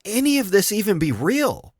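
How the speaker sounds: high-pitched, incredulous inner voice; acted disbelief. Voicing a viewer's thought at absurd news, incredulous. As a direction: wonder it in mock bewilderment, pitch swooping high. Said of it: "you think to yourself".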